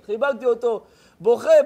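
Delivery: ordinary delivery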